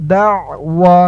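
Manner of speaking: usual speaking voice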